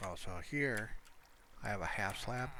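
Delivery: ordinary talk, nothing marked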